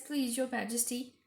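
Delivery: ordinary delivery, nothing marked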